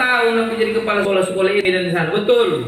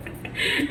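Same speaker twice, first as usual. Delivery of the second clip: laugh; 9 dB quieter than usual. Real, not acted. Laughs during story.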